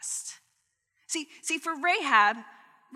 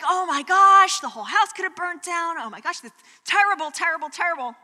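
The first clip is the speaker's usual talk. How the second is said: retold panic; 6 semitones higher, 7 dB louder than usual. Retelling the panic back then.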